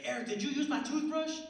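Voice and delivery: high, loud mock accusation; accusing girlfriend. Girlfriend confronting boyfriend over her toothbrush, high and accusing.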